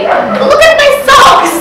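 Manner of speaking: a friend parodies a Russian Instagram post, high, loud and slow; mock show-off. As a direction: drag it out like a smug influencer. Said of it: "you know, say".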